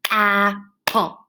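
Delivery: "Apple" split into two even, separate beats; clapped syllables. Shown as a demonstration. Teacher claps out the word, "Apple" in two separate beats. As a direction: break "Apple" in two, one clap per part. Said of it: "practice clapping apple into its parts".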